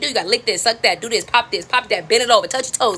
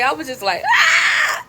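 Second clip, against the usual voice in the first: very high acted scream; overwhelmed wail; exasperated scream; much slower than usual. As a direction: let out a frustrated shriek. Shows own exasperated scream.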